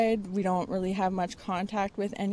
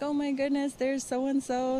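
higher-pitched mock excitement of a kid; excited child quote; 6 semitones higher than usual. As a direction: imitate a son spotting friends, pitched up. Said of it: "he was mostly excited to see his best friends".

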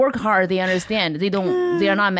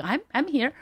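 small, high, soft voice; higher-pitched timid little announcement; imitated overlooked dancer; 6 semitones higher, 4 dB quieter than usual. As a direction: pipe up meekly from the back.

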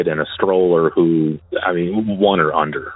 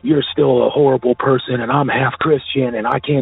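high, contained imitation of screaming; retold screaming; 8 semitones higher than usual. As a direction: imitate a freaked-out son protesting in a raised pitch. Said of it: "he's screaming".

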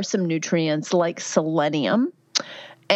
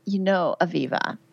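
ordinary delivery

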